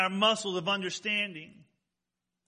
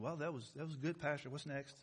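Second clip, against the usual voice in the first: mock congregation member; 5 semitones lower, 14 dB quieter than usual. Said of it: "Sitting there saying".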